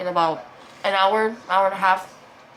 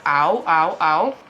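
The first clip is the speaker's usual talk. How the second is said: louder mock cries of pain; retold ouch, louder and strained; 7 dB louder, much slower than usual. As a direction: act out waking up hurting.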